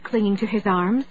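ordinary delivery, nothing marked

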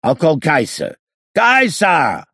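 loud bellow, rising to a shout. A narrator's reading. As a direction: bellow the dog's name. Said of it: "he bellowed".

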